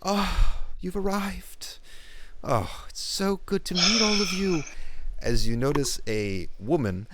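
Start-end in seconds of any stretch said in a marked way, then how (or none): 0.0-4.6 high, delighted queen's welcome; gushing queen. Acted. Queen greeting the party on arrival, high and delighted. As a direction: sing out a warm royal greeting. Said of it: "you literally hear a".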